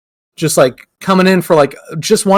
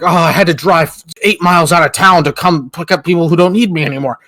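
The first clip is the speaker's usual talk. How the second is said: mock pissed complaint. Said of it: "acting real pissed".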